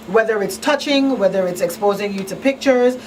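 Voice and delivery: usual speaking voice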